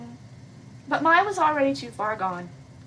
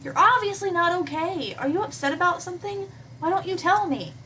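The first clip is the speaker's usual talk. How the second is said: put-on young heroine, pitched higher, concerned; worried Hannah; 7 semitones higher than usual. Hannah confronts PBG in the field at night, concerned. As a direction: press him gently, higher and worried.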